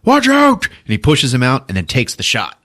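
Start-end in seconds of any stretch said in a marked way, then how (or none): 0.0-0.7 high, loud mock warning yell; a hammed-up heroic warning cry; warning shout. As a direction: yell it high to save him. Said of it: "Liam Neeson is like".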